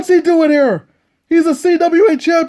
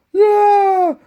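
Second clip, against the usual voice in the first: crowd cheer; 6 semitones higher than usual. Acted.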